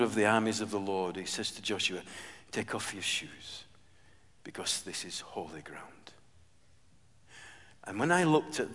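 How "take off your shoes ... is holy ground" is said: soft, slow and reverent, much softer; hushed voice of God. Retold or imitated. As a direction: hush it like holy ground. God speaks to Joshua, hushed.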